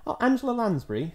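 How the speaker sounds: usual speaking voice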